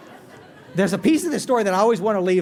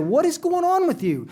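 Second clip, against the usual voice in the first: much higher, puzzled demand; exasperated wife; 10 semitones higher than usual. Retold or imitated. Wife at work to clock-watching husband, mock exasperated. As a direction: imitate a wife who is baffled by him.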